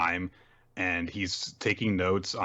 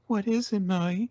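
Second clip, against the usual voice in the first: very high, mock-girlish question; sugary high character voice; expectant lovestruck girl; 13 semitones higher than usual. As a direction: play a swooning girl awaiting a confession. Girl expecting a love confession.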